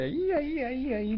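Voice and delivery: vocecita muy aguda de liebre miedosa; miedo actuado. Acted. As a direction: chilla muy agudo, fingiendo que no quiere acercarse. Liebre miedosa señala el lugar. (liebre que no quería ir, miedo actuado)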